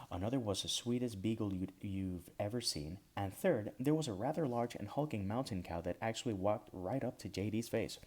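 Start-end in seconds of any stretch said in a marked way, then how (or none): none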